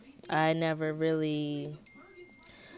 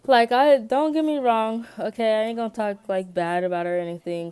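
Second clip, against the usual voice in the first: emphatic; 5 semitones higher, 7 dB louder than usual. Real, not acted. Insisting she won't badmouth her.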